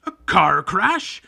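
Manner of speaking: slow, huge roar of disbelief; roaring outrage. Acted. Hagrid to the Dursleys, furious slow roar. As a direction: bellow it at the Dursleys. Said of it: "roared Hagrid".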